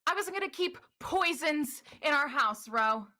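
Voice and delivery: firm protest with a tender name at the end; Ystera defending herself. Acted. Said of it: "Ystera go".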